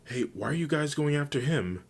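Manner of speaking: put-on high-pitched girl-character voice; perky, inquisitive character question; curious friend. A narrator's reading. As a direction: ask it high and puzzled. Uraraka questions her friends.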